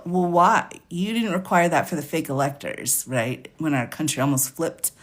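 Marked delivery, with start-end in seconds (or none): none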